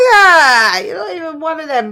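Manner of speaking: loud, high comic dismissal; mock dismissal. Retells the group's dismissive reaction.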